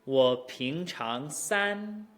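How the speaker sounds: slow, exaggerated tones ending level on "san"; model tone chain. Shown as a demonstration. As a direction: climb into a long "san". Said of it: "second tone into a first tone".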